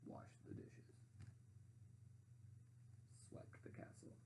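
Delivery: whispered, worn out. A narrator's reading. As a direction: breathe the chores out. Weary chores list.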